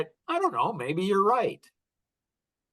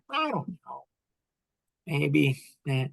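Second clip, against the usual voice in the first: half-hearted mock hesitation, softening off; noncommittal waffle; 5 semitones lower than usual. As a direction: waffle as if undecided. Mock indecisive answer, then rejected.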